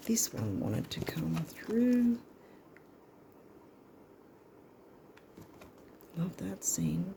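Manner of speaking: soft unvoiced whisper to herself. Real, not acted. A card reader whispering to herself while drawing cards. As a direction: breathe the words as a near-silent whisper.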